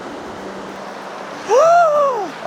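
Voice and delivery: high startled gasp at cold water; sharp gasp. Real, not acted. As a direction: gasp as the icy water hits. Stepping into cold creek.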